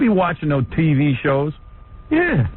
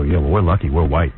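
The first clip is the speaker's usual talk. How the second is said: smug lucky-us voice, pitched much lower; mock smug; 11 semitones lower than usual. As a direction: imitate them boasting, low and self-satisfied. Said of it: "They go".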